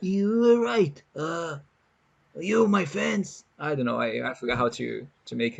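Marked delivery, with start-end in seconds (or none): 0.0-3.4 high, slow put-on Trump voice, drawn out; hammed-up Trump voice, slow and stagey; Trump impression. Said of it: "an impression of Trump".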